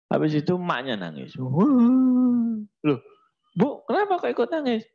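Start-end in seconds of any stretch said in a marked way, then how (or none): 1.4-4.8 high, louder, fast startled voice; husband to wife: why are you crying too, high startled; puzzled husband. Acted. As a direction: burst out high and quick in surprise.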